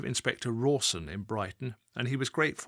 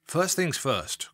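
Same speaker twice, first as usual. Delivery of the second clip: slow, heavy, warning growl; a boss clamping down on a subordinate; 5 semitones higher, 4 dB louder, slower than usual. Acted. Assistant chief constable opening the telling-off, growling. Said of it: "growled the voice".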